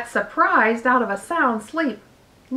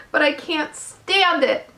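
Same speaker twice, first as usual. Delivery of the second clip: high, distressed outburst; shouting; 5 semitones higher than usual. A narrator's reading. Wilbur protests to dying Charlotte, in a high shout. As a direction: protest in a pained, raised voice. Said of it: "shouted Wilbur".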